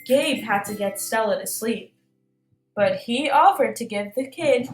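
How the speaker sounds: ordinary delivery, nothing marked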